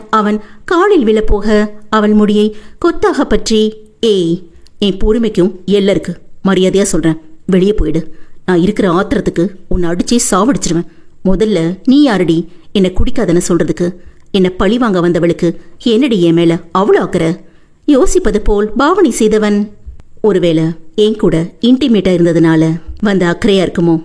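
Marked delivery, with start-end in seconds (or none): 4.1-17.3 low, harsh husband voice; angry husband. Acted. Husband snarls at wife. 20.2-24.0 low, mocking insinuation; sneering husband.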